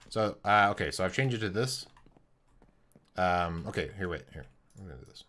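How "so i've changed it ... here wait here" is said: ordinary talk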